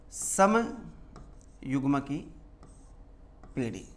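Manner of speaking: समयुग्म की drawn out very slowly, syllable by syllable; term spelled out slowly. Shown as a demonstration. Teacher introducing a new term to the class, drawn out very slowly. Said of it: "next जो term use की".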